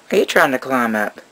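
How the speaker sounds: ordinary talk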